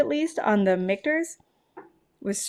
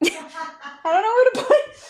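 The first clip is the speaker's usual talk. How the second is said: high flustered cry; 10 semitones higher, 4 dB louder, faster than usual. Real, not acted. Overwhelmed by the strong whisky.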